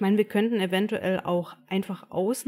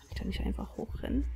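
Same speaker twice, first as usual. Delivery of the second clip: whispering; 3 semitones higher, 6 dB quieter, faster than usual. Real, not acted. Tense sneaking in game.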